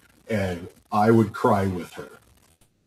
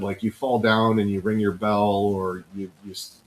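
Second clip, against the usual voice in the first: ordinary talk, nothing marked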